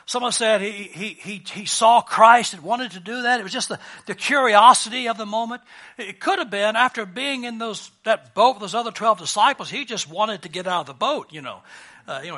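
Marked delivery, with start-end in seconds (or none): none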